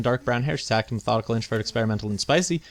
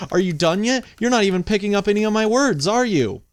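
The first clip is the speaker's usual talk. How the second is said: loud, high exasperated scolding; 7 semitones higher, 4 dB louder than usual. Real, not acted. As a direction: tell off the phone, higher and louder. Scolding phone assistant.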